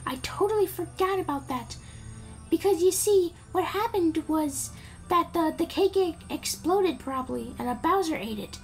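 put-on high princess voice; Peach. Princess thanks Mario for saving her, put-on high voice. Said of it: "Peach is like".

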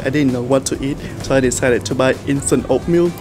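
usual speaking voice

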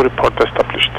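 usual speaking voice

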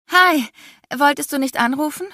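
a nervous, Daisy-Duck-like squeak; squeaky voice. A narrator's reading. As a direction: caught off guard by her lover's visit, squeaking. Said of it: "klang meine Stimme auf einmal wie die von Daisy Duck".

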